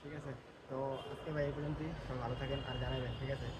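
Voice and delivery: soft, subdued goodbye near worshippers; hushed sign-off. Real, not acted. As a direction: keep the farewell quiet, people are praying. Signing off among people praying. (নামাজিদের পাশে নিচু আওয়াজে বিদায় নেওয়া)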